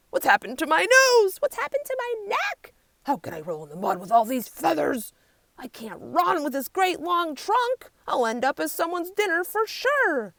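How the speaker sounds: high, loud panicky wail; panicked warthogs. A narrator's reading. Warthogs dismayed at their new shapes, high panicky wail.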